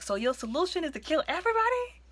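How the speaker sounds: high-pitched disbelieving question; can't believe that's the plan; incredulous reaction. Retold or imitated. Viewer questioning a villain's drastic plan, incredulous.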